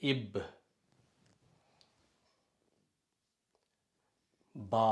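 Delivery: இப் then பா, each said slowly and separately; sounding out syllables. Shown as a demonstration. Teacher shows students how to read the syllables, sounding them out slowly. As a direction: spell out the sounds one by one. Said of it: "என்று உச்சரிக்கலாம்".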